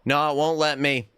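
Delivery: ordinary delivery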